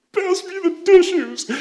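mock teary request. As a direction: play the weepy viewer, high and teary. Teary relative asks for tissues.

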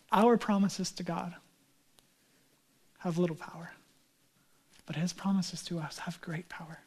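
quiet, falling to a whisper; soft repeat of the quote. Shown as a demonstration. Pastor softly repeats the quote, dropping to a whisper. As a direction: let the quote sink in, hushed. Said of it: "repeats the quote softly".

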